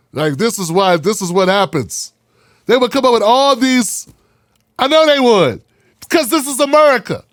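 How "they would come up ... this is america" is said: heated. Real, not acted. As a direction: push it up with heat. Heated rant about America.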